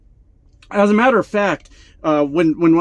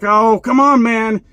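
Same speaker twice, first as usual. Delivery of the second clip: higher, louder hammed-up protest; mock pleading kid; 7 semitones higher, 6 dB louder, slower than usual. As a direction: plead with a friend like a put-out kid. Acts out kid pleading with friend.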